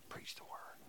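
whispered like a secret; mock secret whisper. Shown as a demonstration. Paul wrongly pictured passing Timothy a secret, whispered. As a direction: breathe it as a hushed secret. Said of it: "So here's the secret".

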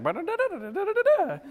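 high, fast puppet babble; squeaky puppet chatter. Retold or imitated. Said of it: "I just talk for them".

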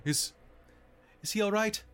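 high, anxious wife; worried woman's voice. Acted. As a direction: ask it with rising worry. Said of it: "She says".

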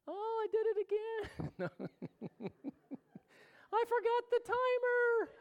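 high, squeaky mock wail of a wife over burnt food; imitating a crying wife. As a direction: play her tearful upset for laughs. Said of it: "crying 'cause something got burnt".

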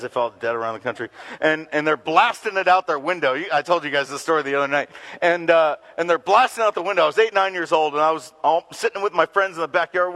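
usual speaking voice

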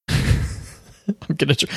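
breathy giggle fighting through words; trying not to crack up; laughing. Real, not acted. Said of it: "get through this card without laughing".

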